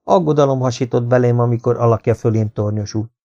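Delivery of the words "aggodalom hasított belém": plain reading voice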